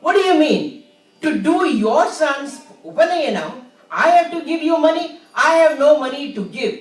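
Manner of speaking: loud, fast angry retort; furious shopkeeper refusing; angry shout. Acted. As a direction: bark it at the beggar, outraged. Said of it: "got angry".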